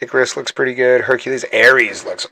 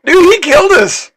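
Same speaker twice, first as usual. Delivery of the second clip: high, loud disbelieving cry; shocked yell; 8 dB louder than usual. Real, not acted. Gamer losing the battle to Hercules, shocked yell. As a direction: shout at the screen in disbelief.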